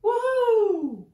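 loud, high-pitched whoop; full-voiced cheer, way up high; yelled woohoo. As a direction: yell a long, soaring woohoo. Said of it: "Ian and Olivia yelled".

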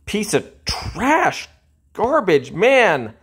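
high, drawn-out insults; angry outburst. Real, not acted. Beaten gamer spits insults at the game, high and slow. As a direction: drag out the insults in disgust. Gamer raging after defeat.

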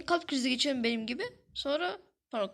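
usual speaking voice

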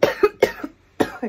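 covered cough then speaking; coughing into hand. Real, not acted. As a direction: hand muffling mouth. Seen 1: Fist covers mouth during cough, lowered by about 1.1s.

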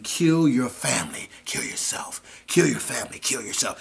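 soft, menacing whispered chant; demon voices whispering. Retold or imitated. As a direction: hiss the command over and over, hushed. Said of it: "They were saying".

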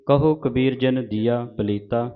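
normal talking voice

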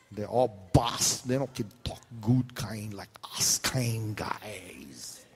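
slow, breathy whisper of a pidgin line; mock-secret pidgin whisper; hushed aside. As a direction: lean in and whisper it slowly. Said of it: "mock pidgin lament".

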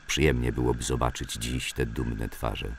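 plain reading voice

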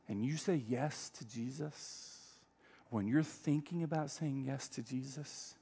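usual speaking voice

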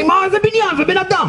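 very high, strained mock outburst; enraged sergeant. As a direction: explode like a sergeant at an idiot recruit. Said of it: "ההוא נהיה אדום".

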